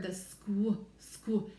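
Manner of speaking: squ said alone, cut short; isolating squ. Shown as a demonstration. Teacher isolating the squ blend. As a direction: give only the squ sound. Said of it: "I hear a blend".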